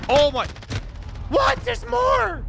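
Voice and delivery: high, loud yelling in disbelief; excited shout. Real, not acted. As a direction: shout as the enemies keep coming. More enemies appear suddenly.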